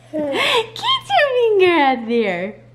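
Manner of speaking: laughing scold. Real, not acted.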